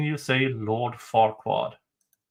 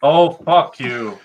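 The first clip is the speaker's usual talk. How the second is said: loud; 8 dB louder, slower than usual. Real, not acted. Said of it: "I said fork".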